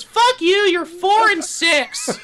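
put-on very high, loud kid outburst; hammed-up squeaky child rage, slowing down; furious nine-year-old gamer. Child insulting teammate's score, shrieky mock anger. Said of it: "And he goes".

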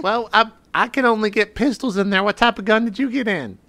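high, chatty modulated voice; high-pitched Higgins. Acted. Player as Higgins asking the rival about guns, high-pitched. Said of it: "High pitched".

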